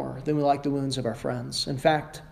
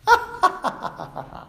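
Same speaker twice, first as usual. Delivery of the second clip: high burst of laughter; amused laughing burst. Real, not acted. Preacher laughs at own sarcasm.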